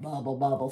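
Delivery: low, rounded bubbling sound; bubbling creek. A narrator's reading. Storyteller voices the creek, low bubbling. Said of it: "The creek went bubble".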